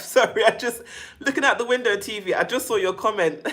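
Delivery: laughing while reading a comment. Real, not acted. Reading a funny live comment.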